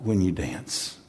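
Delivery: a husband sings to his bride; tender love words. Retold or imitated. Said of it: "He says".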